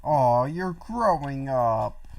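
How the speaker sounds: drawn-out mock-proud aww; slow, teasing sing-song; teasing coo. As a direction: coo at them like a proud parent. Teasing friend over beer taste.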